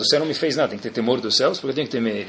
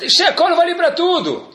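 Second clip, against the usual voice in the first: high, drawn-out protest; exclaimed as a confident excuse; insistent excuse; 12 semitones higher, slower than usual. Acted. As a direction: insist on it slowly, pitched way up. Acted excuse, then calmly conceded.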